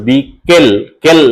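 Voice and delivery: "বি" apart from "কেল", which closes on l; closed syllable. Shown as a demonstration. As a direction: repeat "কেল" alone, stopping on the final l. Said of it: "জিভটা উপরে গিয়ে ঠেকছে".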